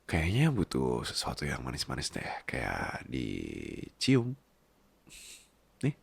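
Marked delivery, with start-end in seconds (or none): 2.5-6.0 very slow, lower, softer hint; teasing flirt. Acted. Flirting with partner to ask for a kiss. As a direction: drag it out to coax a kiss.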